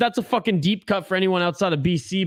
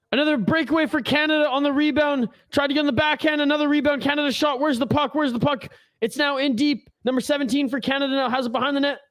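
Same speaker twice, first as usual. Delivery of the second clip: higher, quicker game call; live play-by-play; 4 semitones higher, faster than usual. Acted. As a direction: chase the puck with your voice.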